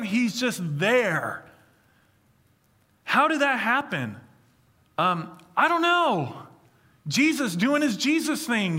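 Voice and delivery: ordinary talk, nothing marked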